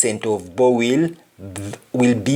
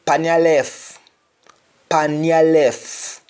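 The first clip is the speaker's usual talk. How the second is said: "pan your left" in Jamaican, with no final t; Jamaican patois; 3 semitones higher, slower than usual. Shown as a demonstration. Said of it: "You simply say".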